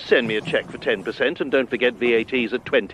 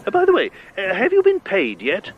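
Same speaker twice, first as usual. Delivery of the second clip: normal talking voice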